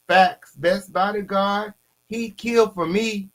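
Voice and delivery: high, slow mock voice; mocking quote of David. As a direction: pitch it way up to mock him. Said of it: "David said".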